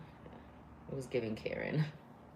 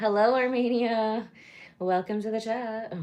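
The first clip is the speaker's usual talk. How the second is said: excited greeting; 8 semitones higher, 6 dB louder than usual. Real, not acted. Greeting live chat viewers.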